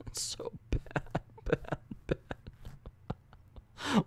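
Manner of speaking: breaking into a weak, near-whispered whimpering laugh; despairing laughter. Real, not acted. Reviewer overcome by terrible dialect writing, helpless laughter. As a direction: lose your voice laughing in despair.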